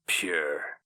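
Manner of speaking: ordinary delivery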